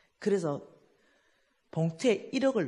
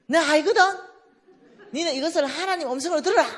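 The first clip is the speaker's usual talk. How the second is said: higher, louder fast brush-off; loud retort; 9 semitones higher, 8 dB louder, faster than usual. Retold or imitated. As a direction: snap it back and hang up. Caller scolding then hanging up.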